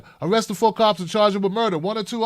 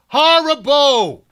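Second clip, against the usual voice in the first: slow, high, loud stretched horrible; disgusted, dragged-out Horrible; drawn-out emphasis; 6 semitones higher, 5 dB louder, much slower than usual. Real, not acted.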